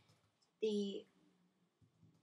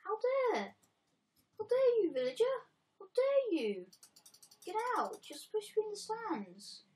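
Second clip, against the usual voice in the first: loud, high outraged shout; shaking with anger; 10 semitones higher, 8 dB louder than usual. Real, not acted. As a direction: bellow it in shaking fury. Outraged at villager.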